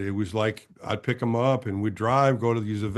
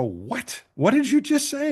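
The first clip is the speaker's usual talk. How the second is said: pitched way up in stunned disbelief; shocked disbelief; 13 semitones higher than usual. Retold or imitated. [name] stunned that Vida agreed, high shocked disbelief. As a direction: snap round, high and stunned. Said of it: "my neck swerved and I go".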